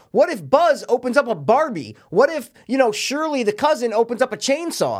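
high, fast-rising mock panic; excited kid. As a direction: voice a kid gasping at wrong gifts. Imagined kid panic over toys.

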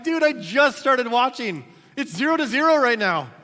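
exasperated reply. Retold or imitated. Pastor retelling his text back to a buddy, exasperated. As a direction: complain that the game just started. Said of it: "I'm like".

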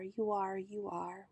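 soft refrain, dying away; fading echo. A narrator's reading. Owl's serenade trailing off, softly echoed.

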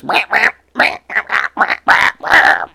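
choked, gurgled squawks rising in pitch; gurgling cat cry. Retold or imitated. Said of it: "sounds like it's fucking gurgling".

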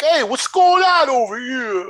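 loud, slow jock counselor voice; big dumb jock calling out; frat bro. Retold or imitated.

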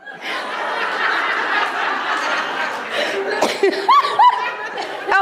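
high airy laugh trailing down; long breathy laugh. Real, not acted. Preacher laughing at her toilet-paper confession, breathy. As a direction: let a long unvoiced laugh spill out.

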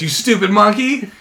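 high, loud play-acted scolding; mock pissed. Crowd turning on the monkey, mock pissed. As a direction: imitate an outraged crowd in fun. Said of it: "everyone was pissed".

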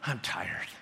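a tired friend answering a greeting; weary reply. Retold or imitated. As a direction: sag into it like someone exhausted. Acted small talk reply.

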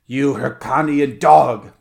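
loud, slow, savage cry; barbarous snarl. A narrator's reading. Wild stranger confronting his enemy, barbarous and loud. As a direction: spit out the insult at full volume, drawn out. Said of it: "mouthed this apparition in a barbarous accent".

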